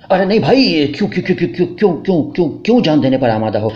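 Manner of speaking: high, stammering burst of alarm; alarmed protest. Acted. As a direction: stop her in a panic. Husband stops wife from shouting.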